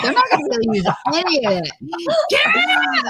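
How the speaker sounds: rushed high plea building into a crying shriek; fast mock rant ending in a sobbing scream; panicked yell. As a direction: imitate her rattling it off then screaming for help. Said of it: "She's like".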